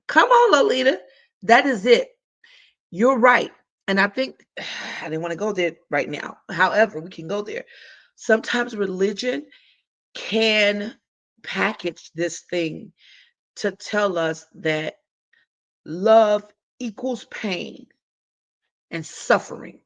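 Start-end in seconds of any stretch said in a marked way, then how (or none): 0.1-3.5 loud and high, cheering her on; excited praise. Real, not acted. Host cheering commenter Lolita's point, loud and excited. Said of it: "excited agreement with comment". 8.2-19.8 slow emphatic. Real, not acted. Slow weighted phrase.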